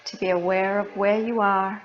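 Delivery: soft, unhurried meditation voice with long pauses; guided meditation. Acted. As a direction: gently guide listeners into their breath, leaving space between phrases.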